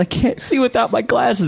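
high, plaintive whimper; high helpless cartoon wail; cartoon whine. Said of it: "He says".

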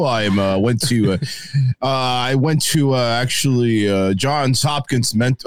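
low, measured announcer voice. Acted. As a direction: introduce yourself like a deep-voiced announcer, dragging the hesitations. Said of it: "that announcer voice".

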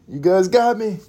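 high-pitched pretend surrender; hammed-up high give-in; mock confession. Repeats confession in raised voice.

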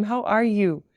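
ordinary delivery

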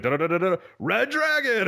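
high shouted catchphrase; wrestler catchphrase. Retold or imitated. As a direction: yell the name to pop the crowd. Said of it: "he was like".

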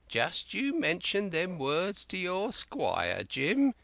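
high, slow, cracked voice of a wild marooned sailor; Ben Gunn. Acted. Marooned Ben Gunn eagerly pleading with young Jim, high and slow.

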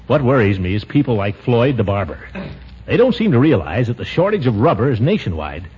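sincere closing appeal; earnest diary reading. Acted. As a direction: read it like a heartfelt diary.

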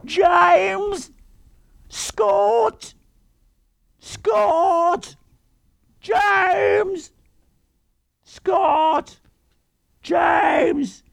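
very high, drawn-out wailing calls; mock British-accented whine, calling for the boys; whining girl calling out. Podcaster mocking horror heroine, high whiny wailing. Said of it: "this girl running around going".

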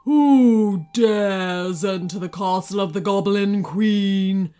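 loud, drawn-out dragon grumble; big sleepy dragon, grumbling out a challenge. A narrator's reading. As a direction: boom it out like a drowsy, grumpy dragon. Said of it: "grumbled the dragon".

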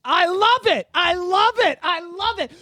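high, loud, delighted repeats; excited cheering. Real, not acted. To co-host about conservatives and the draft, delighted cheering. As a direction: whoop it up like you're loving every word.

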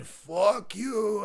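high, stretched mock yell; imitated yelling. Storyteller imitating his friend cursing while the car loads, mock yell. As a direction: reenact a top-of-the-lungs holler without full volume. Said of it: "Just starts yelling".

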